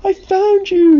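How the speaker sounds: loud, very high exclamation; high, excited shout; joyful cry. A narrator's reading. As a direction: cry out on finding a lost friend. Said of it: "he cried".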